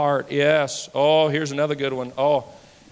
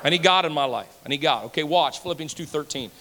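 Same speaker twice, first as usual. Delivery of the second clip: usual speaking voice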